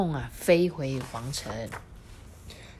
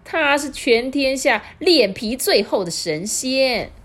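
loud, high, slow yell; old wife shouting in a rage; roaring old woman; 7 semitones higher, 6 dB louder than usual. Acted. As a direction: bellow the insult. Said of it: "这老婆婆就大吼说".

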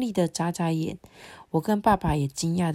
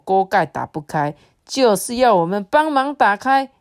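plain reading voice

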